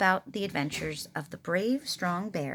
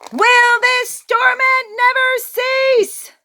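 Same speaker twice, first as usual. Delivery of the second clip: much higher, louder, very slow wail; melodramatic lament; 15 dB louder, much slower than usual. A narrator's reading. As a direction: ham up the suffering.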